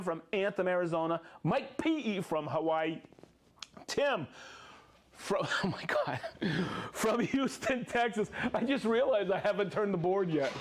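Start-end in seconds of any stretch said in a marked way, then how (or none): none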